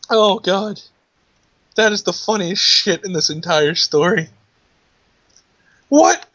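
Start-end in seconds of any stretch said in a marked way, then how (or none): 5.9-6.3 high, loud alarmed question; panicked caller asking what happened. Acted.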